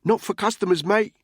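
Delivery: high, loud turn-down; brusque refusal. A narrator's reading. Cab controller denying a customer the toilet, brusque refusal. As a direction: refuse him firmly and loudly.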